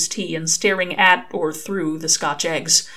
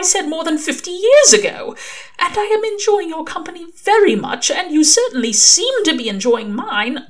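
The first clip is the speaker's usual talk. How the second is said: higher, louder earnest angel voice; Aziraphale, earnest angel; 10 semitones higher, 6 dB louder than usual. Acted. Angel tells Crowley it is a date, higher and earnest. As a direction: plead your case with rising feeling.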